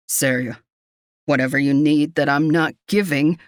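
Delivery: low, bleak man's voice; bleak plea. A narrator's reading. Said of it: "the bleakness in his voice".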